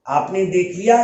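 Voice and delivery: usual speaking voice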